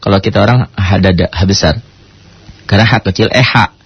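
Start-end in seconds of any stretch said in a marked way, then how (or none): none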